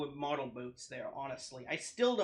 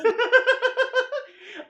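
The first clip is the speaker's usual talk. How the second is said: very loud, high real laugh; 13 dB louder than usual. Real, not acted. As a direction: explode into high-pitched laughter. Laughing at the played story.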